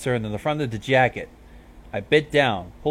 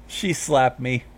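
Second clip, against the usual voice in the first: ordinary delivery, nothing marked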